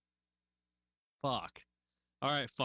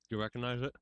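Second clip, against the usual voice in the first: ordinary talk, nothing marked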